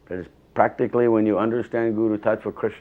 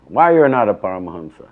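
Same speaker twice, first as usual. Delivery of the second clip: louder, pointed demand on WHY; teacher challenging the disciple, louder; retold scolding; 9 dB louder than usual. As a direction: throw the question back like a rebuke. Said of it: "to get yelled at".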